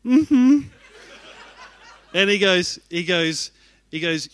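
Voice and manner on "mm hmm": much higher and louder tight agreeing hum; meek mm-hmm. Retold or imitated. Pastor meekly agreeing aloud with the speaker's marriage advice. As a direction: nod along meekly outwardly. But on the outside.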